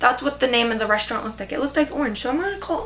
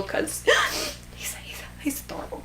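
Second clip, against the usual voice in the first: gasping squeak dropping to a whisper; gasp, then barely get the words out; overcome gushing; 8 dB quieter than usual. Real, not acted. Fan overcome by adorable Gus, gasping and breathless.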